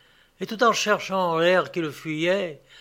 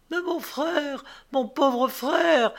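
high, drawn-out lament; acted wail of distress; anguished cry; 10 semitones higher, slower than usual. A narrator's reading. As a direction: plead for a doomed brother, pitch way up. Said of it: "s'écria la jeune fille".

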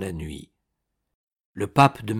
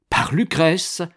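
higher, louder incredulous question; shocked echo; 7 semitones higher, 5 dB louder, slower than usual. A narrator's reading. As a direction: repeat it as a rising, startled question. (question stupéfaite)

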